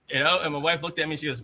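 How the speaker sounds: ordinary delivery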